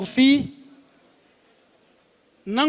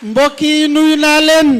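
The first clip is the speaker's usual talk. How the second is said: calling out to crowd; 3 semitones higher, 8 dB louder than usual. Real, not acted. Greeting the crowd at rally.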